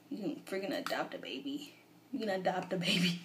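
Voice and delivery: whispered amazement; hushed thrill; excited whisper. Real, not acted. Excited about game feature.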